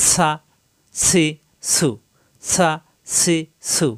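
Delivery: সা, সি, সু each led by a strong hissing s; short syllables with a sharp airy s in সা, সি, সু; whistling sibilant. Shown as a demonstration. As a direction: push air past the teeth on সা, সি, সু. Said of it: "বাতাস বের হবে".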